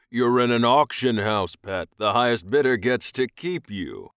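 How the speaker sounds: loud, commanding captor voice; self-assured alien master, imposing. A narrator's reading. Alien buyer explaining auction to captive, commanding. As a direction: lay it out with loud authority.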